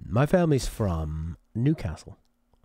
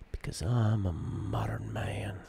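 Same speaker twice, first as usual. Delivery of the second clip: whispered, slow, jokey aside; mock whisper; 8 dB quieter, slower than usual. Joking to the viewers he's a modern man, whispered. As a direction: whisper it like a secret boast.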